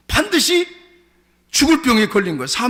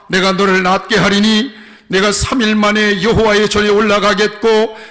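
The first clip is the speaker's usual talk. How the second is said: loud, level-pitched declamation; forceful scripture recitation; 6 dB louder than usual. Acted. Preacher reads God's answer to the king, declaiming. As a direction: proclaim it at full force, building to the end.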